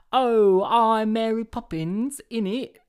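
high, loud put-on Cockney of Mary Poppins; Mary Poppins Cockney parody; mock Mary Poppins accent. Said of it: "my best impression of you in Mary Poppins".

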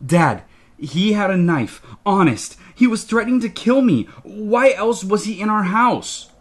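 put-on high, urgent pleading kid; frantic protest. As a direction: blurt it out in a panic. Panicked kid protesting to dad.

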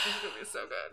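low, soft movie-trailer voice; teaser trailer voice. Pretend. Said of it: "this is a teaser".

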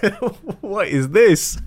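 high-pitched, laughing astonishment; stunned disbelief. Retold or imitated. Magician retelling Sachin Tendulkar walking in, stunned. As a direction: relive the shock in a high, incredulous voice.

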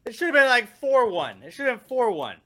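high, loud insistence; exasperated arguing. Real, not acted. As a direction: argue back, worked up and despairing. Arguing despairingly with co-host.

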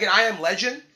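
ordinary talk, nothing marked

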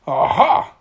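loud, gleeful "aha"; excited cry. A narrator's reading. Mister Tickle spies his next victims, loud excited cry. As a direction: pounce on it loudly. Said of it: "cried Mister Tickle as he spied".

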